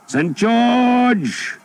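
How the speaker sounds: high, loud rallying yell; battle cry. A narrator's reading. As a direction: roar it as a war cry. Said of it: "the English shouted".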